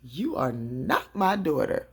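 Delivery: slow, loud, word-by-word denial; emphatic denial. Retold or imitated. Mama Rose rejects her daughter, slow emphatic denial. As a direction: land each word hard and slowly.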